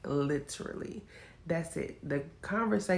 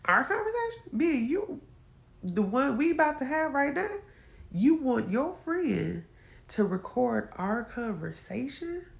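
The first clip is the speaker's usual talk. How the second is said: outraged disbelief; 6 semitones higher than usual. Retold or imitated. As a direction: demand to know how he dares. Husband wants call recorded.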